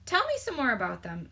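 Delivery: usual speaking voice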